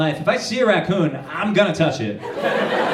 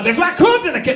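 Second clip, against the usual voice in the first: much higher, scornful sass; sassy attitude; 13 semitones higher, 3 dB louder than usual. Retold or imitated. Kitchen lady replaying her raccoon excuse, high scornful sass. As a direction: treat him like an idiot. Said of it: "with, like, attitude".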